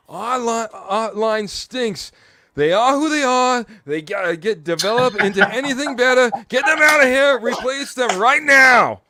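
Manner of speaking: over-the-top shouting sports-radio host; Kurt Valente impression. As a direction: rant like a fired-up host yelling into the mic. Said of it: "try to do my best Kurt Valente impression".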